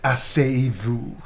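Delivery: slow, laughing seductive French; hammed-up seductive command; romantic French lover. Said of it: "Romantic blushing type line".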